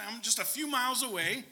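ordinary delivery

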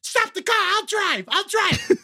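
hammed-up yelp at the driver; mock yelling. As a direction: act a frantic passenger demanding control. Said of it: "Then I started yelling".

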